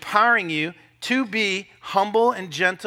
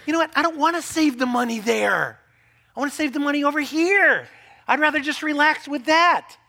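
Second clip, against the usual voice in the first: higher, louder mock retort; stubborn partner; 9 semitones higher, 5 dB louder than usual. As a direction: dig in your heels. Other spouse retorting.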